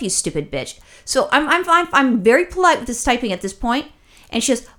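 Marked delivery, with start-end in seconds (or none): 0.0-0.8 much lower and softer, a throwaway jab under the breath; muttered insult. Retold or imitated. Insulting the agent under her breath, muttered. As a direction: drop it quietly like a private aside.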